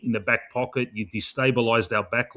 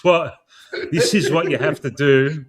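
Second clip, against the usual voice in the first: laughing; 3 semitones higher, 5 dB louder than usual. Real, not acted. Fan laughing while telling coach Fly what to do. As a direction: laugh through a mock-stern instruction.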